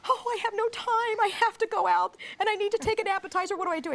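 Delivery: high, rushed panicky voice; frantic guest. Retold or imitated. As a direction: play a frazzled host in a hurry. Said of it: "when they say".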